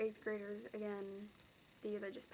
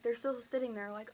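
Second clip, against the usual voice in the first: normal talking voice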